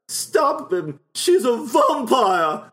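loud, high cry with a drawn-out VAMPIRE; hammed-up horror heroine exclamation; mimicked film line. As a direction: imitate a melodramatic movie warning, stretching "vampire". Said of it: "I love how she says that".

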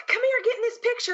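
high mock insistent call to join the photo; playfully voices Shag waving her over; imitating Shag. Retelling a con photo, voices Shag calling, high and pushy. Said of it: "Shag's like".

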